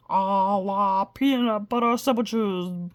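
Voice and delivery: slow, grand magic words; magic incantation. Acted. Mumford casts his magic phrase over the violin, slow and grand. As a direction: intone it like a spell. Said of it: "the magic phrase".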